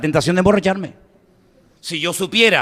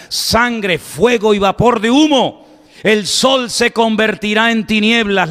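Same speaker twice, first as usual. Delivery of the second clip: emphatic preacher's recitation in measured phrases; ringing public sermon oratory; declaimed scripture; 5 semitones higher than usual. Acted. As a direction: proclaim the prophecy to a whole crowd. Declaims scripture at full force.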